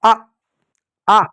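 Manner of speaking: short, separate आ sounds; quick "aah", as if hurt; cry of hurt. Shown as a demonstration. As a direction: demonstrate आ like a brief ouch. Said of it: "घाव लगता है बोलते हैं ना आ".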